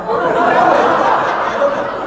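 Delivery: loud breathy laugh; hearty laugh at the joke. Real, not acted. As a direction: burst out laughing.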